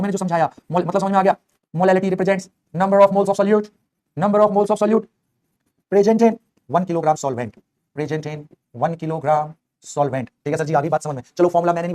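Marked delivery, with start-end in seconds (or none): 1.7-10.3 drawn-out words, phrase by phrase; slow dictation. Shown as a demonstration. Teacher writing out the definition for students, slow dictation. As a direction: dictate slowly, pausing between repeated phrases.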